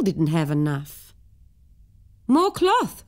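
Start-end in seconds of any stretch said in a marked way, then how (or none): 2.3-3.0 high, pleading cry; a high desperate call. Acted. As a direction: beg for more cloth, pitched way up. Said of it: "cried the tailor desperately".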